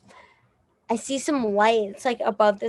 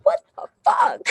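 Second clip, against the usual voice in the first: much higher, startled outburst; shocked disbelief. Retold or imitated. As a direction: squeal it like you can't believe it. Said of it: "I'm like".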